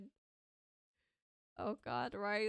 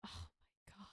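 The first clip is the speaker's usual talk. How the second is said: whisper; 15 dB quieter than usual. Real, not acted. Horrified reaction to the scene.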